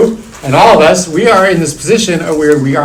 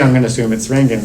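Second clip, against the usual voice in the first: normal talking voice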